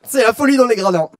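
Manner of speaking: loud, high, hyped-up race call; excited announcer. Real, not acted. Host calls the burger race.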